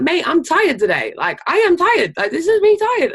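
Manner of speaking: high, loud, bubbly claim of being tired; bouncy mock tired. To coworkers, insisting she's tired in a high, peppy voice. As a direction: prove you're tired while sounding wide awake. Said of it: "I said to them".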